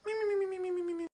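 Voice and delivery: very high squeaky "mi-mi-mi" snore; mock snoring. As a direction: imitate cartoon snoring in rapid little squeaks. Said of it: "She's snoring like".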